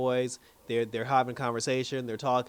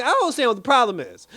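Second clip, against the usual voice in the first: laughing disbelief; 9 semitones higher, 7 dB louder, faster than usual. Retold or imitated. Own baffled reaction, laughing.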